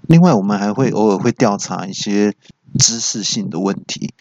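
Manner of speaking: ordinary talk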